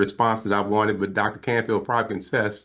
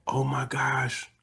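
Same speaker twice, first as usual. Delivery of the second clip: slow, hushed imitation of amazement; awed whisper; 4 semitones higher, 5 dB quieter, much slower than usual. Husband learning the baby is alive, imitated awed whisper. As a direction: whisper it as if stunned. Old man retold learning the news.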